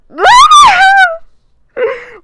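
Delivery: loud, high shrieking laugh; screaming laugh. Real, not acted. Fangirling over Andrew Garfield, shrieks into the mic. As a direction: shriek with giddy excitement into the mic. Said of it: "I screamed into the mic".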